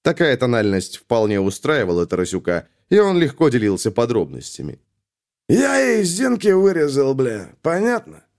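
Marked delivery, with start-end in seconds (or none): 5.5-8.2 menacing. A narrator's reading. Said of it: "стращал Тарасюк".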